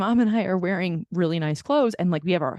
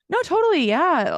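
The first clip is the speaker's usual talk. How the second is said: overly eager agreement; 9 semitones higher, 4 dB louder than usual. Retold or imitated. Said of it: "I was like".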